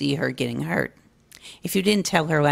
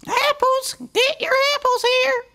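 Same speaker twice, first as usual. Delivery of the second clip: cried out. Acted. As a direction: call out to the whole market like a young filly. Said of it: "she cried".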